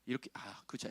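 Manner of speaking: usual speaking voice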